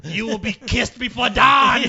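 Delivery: slow loud spooky threat; creepy villain voice. Pretend. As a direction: announce it like a horror villain. Playing the creepy lady.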